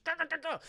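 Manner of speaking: high, rapid-fire stabbing syllables; imitated stabbing noise. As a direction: sound out repeated frantic jabs. Imitating repeated stabbing motion.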